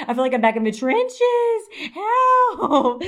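high, drawn-out mock wail for help; mock distress. Back out of prison, hearing the slang, mock wailing. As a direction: wail it like a melodramatic victim. Acting horror at hearing the phrase.

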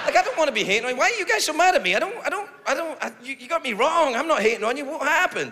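high, flustered self-defence; acted pleading protest. Comic to transgender figure who is mad, high acted pleading.

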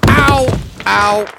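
sharp loud cries of pain; pained yelps. Acted. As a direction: yelp as you stub your toe. Tripped over in the dark.

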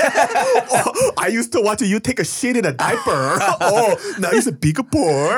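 mocking. Mocking mother belittles grown son.

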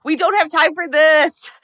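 high, loud, hammed-up exasperation; fed up, push it up high and loud; impatient Clarice. Clarice losing patience with Hannibal's riddles, high and loud.

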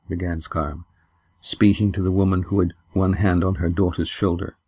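ordinary delivery, nothing marked

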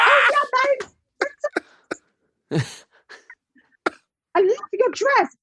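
high, airy sweet voice. Retold or imitated. [name] complimenting her dress, lightly imitated high and sweet. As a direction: lightly play a gushing star. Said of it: "she was like".

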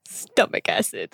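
very low, slow ominous echo; deep voice. Acted. Echoes in deep voice.

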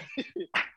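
breathy embarrassed laugh; quick airy laugh at herself; embarrassed giggle. Real, not acted. Embarrassed after mistake.

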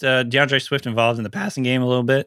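normal talking voice

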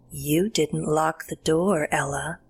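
slow, eerie raspy taunt; dry, raspy creature. A narrator's reading. Creature at the window to Ella, slow raspy taunt. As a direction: draw it out, creepy and dry. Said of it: "the dry, raspy voice say the words".

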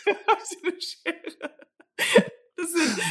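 slow, soft laughing. Retold or imitated. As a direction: laugh the line out. Repeats the joke laughing.

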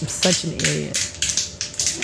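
slow whisper to self; slow whispered self-rebuke; whispered self-scold. Real, not acted. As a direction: mutter it under breath.

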